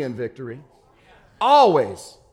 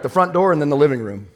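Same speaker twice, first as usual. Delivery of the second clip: ordinary delivery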